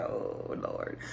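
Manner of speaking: breathless whispered oh Lord; whispered dread. Retold or imitated. Viewer dreading what Katie will say, whispered. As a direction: whisper it like you fear what comes.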